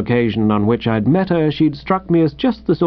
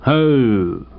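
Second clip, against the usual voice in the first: skeptical policeman oh. Acted.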